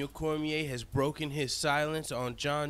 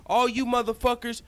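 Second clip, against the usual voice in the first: defiant rant at haters; 4 semitones higher than usual. Pretend. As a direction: call out every hater one by one. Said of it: "What he's saying is".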